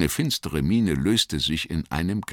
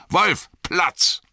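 higher, louder command to the dogs; calling the dogs; 14 semitones higher, 4 dB louder than usual. Acted. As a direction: call the dogs to heel. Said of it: "rief er".

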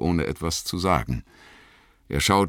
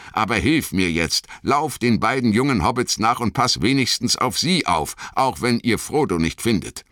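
higher, pressing instructions; urgent orders; 10 semitones higher, 4 dB louder than usual. A narrator's reading. Aragorn sends Boromir after the young hobbits, urgently. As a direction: give pressing orders, pitched up.

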